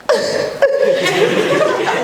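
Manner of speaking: high mock crying wail. Mother bursting into tears at the news, mock wail. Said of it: "Mak teriak".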